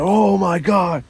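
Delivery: high, slow "oh my God" in alarm; startled and drawn out; panicked gasp. Retold or imitated. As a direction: let it rise in slow panic. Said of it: "I'm like".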